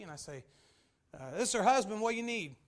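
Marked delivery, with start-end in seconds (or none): 1.1-2.6 brusque husband. Acted. Husband curtly answers a telemarketer.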